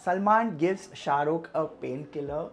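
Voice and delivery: normal talking voice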